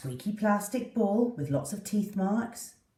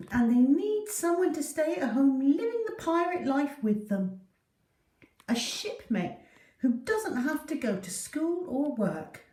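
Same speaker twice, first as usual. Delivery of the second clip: higher, longing little dog's voice; a pup dreaming of a shipmate; hopeful dog voice; 8 semitones higher than usual. Acted. Poppy wishing for a shipmate, high and hopeful.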